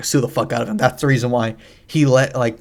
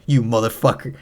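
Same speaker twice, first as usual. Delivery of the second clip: ordinary delivery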